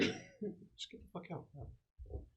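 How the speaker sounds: hushed urgent whisper. Real, not acted. Teammate quietly urging a fast retreat, whispered.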